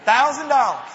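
big, high, punched reveal; triumphant shout. Real, not acted.

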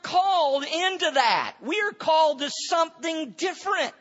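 high, loud revival preacher; full-force preaching. Real, not acted.

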